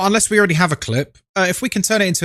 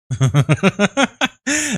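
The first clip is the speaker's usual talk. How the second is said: amused laugh; 3 semitones higher than usual. Real, not acted. Streamer joking with chat at the start, chuckling. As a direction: run of quick chuckles.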